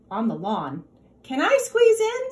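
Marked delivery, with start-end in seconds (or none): 1.2-2.3 much higher, louder goat voice; a high, pleading goat asking for room; eager nanny goat. A narrator's reading. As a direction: squeeze in as a bright little goat. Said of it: "said Nanny Goat".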